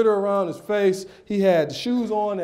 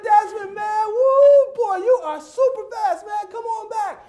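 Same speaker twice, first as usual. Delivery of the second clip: very high, excited mock holler; strained high coach yell, stretched out; hollered praise; 14 semitones higher, 4 dB louder than usual. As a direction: whoop and call the kid back from afar. Hollers praise at runaway child.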